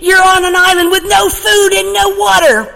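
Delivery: fast, high, loud frantic rant; hammed-up outraged castaway, racing; went nuts. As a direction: go nuts on him, rattling it off. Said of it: "the first man went nuts".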